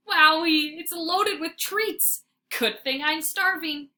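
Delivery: high, loud gleeful wonder; dog delighted by treats; excited. A narrator's reading. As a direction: savor it, delighted.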